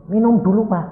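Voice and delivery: high, loud mock alarm; nervous nurse. Scared nurse offering the patient a drink, nervous. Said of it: "kan takut".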